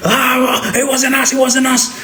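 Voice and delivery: high, frantic denial; panicked denial. Retold or imitated. Tied-up men denying to the boss, high panicked whine. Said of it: "they're like".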